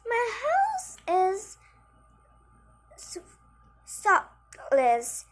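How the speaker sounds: high, proud housewife boast; proud farmer's wife. Acted.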